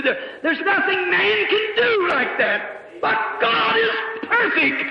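fervent preaching, swelling up and down; fervent sermon, climbing to a shout on God; preaching at full force. Acted. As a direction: preach it out to the congregation with rising fire.

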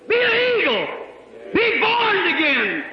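preacher shouting a call to be born again; exhorting shout. Real, not acted. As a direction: cry it out to the whole congregation.

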